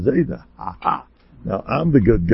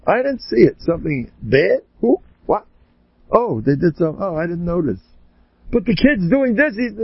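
higher, innocent playing dumb; mock oblivious; 7 semitones higher than usual. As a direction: feign not noticing anything.

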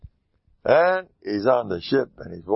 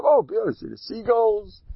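fake cheer; 12 semitones higher than usual. Man pretends nothing happened to captain.

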